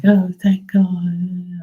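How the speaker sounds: high, slow, drawn-out relief; mock relieved woman. Mimicking the neighbor's relief, high and slow. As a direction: stretch it out like a prissy sigh of relief. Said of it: "She was like".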